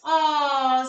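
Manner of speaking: ordinary delivery, nothing marked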